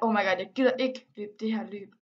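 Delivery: ordinary talk, nothing marked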